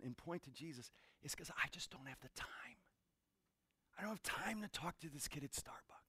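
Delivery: whispered, sheepish admission; confessing whisper. Real, not acted. Pastor confessing to the congregation, whispered. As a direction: whisper it like a guilty secret. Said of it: "I had to confess".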